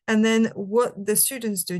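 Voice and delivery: ordinary delivery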